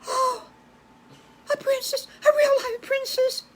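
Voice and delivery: very high, gasping astonishment; high-pitched awestruck wonder; starstruck amazement. Acted. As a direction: squeal it in disbelief. Animals amazed at meeting princess.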